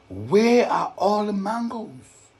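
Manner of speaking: high, loud, slow alarmed question; lightly voiced girl in shock; surprised girl. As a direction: Jada gasping at the empty tree. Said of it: "she asked".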